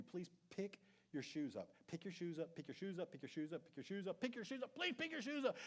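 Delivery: imitating a nagging spouse, rising in pitch. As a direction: keep repeating it, climbing each time. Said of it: "whenever they've told you".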